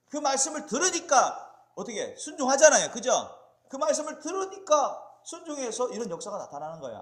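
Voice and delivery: loud, high, insistent sermon voice; forceful preaching. Real, not acted. Preacher showing the congregation what obedience brought, forceful preaching. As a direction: hammer the point to the congregation.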